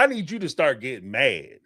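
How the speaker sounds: ordinary talk, nothing marked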